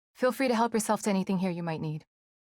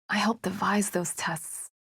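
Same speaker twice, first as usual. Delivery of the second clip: slow whispered confession; guilty whisper; slower than usual. Acted. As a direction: whisper it slowly, ashamed.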